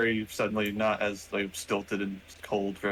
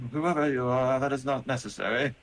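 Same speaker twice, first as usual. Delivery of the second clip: higher, louder robot voice; metallic robot voice; 4 semitones higher, 3 dB louder than usual. Acted. Robot answers the player who screamed, metallic robot voice. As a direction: speak as a formal machine. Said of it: "you just hear in his metallic voice".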